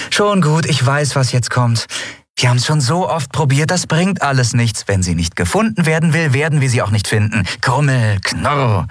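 lightly voiced heroine, pleading; Endriel. (leicht gespielte junge Frau, drängend)

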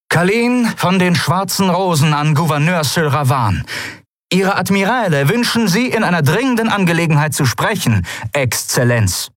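high, projected formal address; formal officer. A narrator's reading. Kalin calling the governor on open channel, formal. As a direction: hail a superior officially.

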